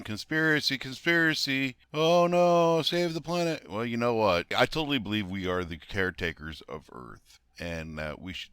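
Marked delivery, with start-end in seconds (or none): 0.0-3.7 loud, high-pitched mocking cries; hammed-up panic, called out high and loud; mock alarm. Mocking climate worriers as conspiracy alarmists, mock alarm.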